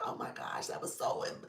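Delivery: whispered gushing, voice fading into a laugh; breathless whisper. Retold or imitated. Imitating a stunned viewer, awed whisper. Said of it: "it's like".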